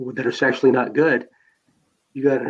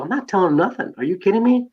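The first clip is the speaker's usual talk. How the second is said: higher, mock-defiant brush-off; defiant teen; 7 semitones higher than usual. A teen refusing to tell his dad about the car, higher and mock defiant. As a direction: toss it back like a cocky kid, pitched up.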